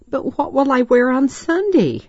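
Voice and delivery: put-on high-pitched, fretful child's question; mildly pouty little girl, pitched well up; frowning worry. A narrator's reading. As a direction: fret about it in a high voice. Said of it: "Desiree frowned".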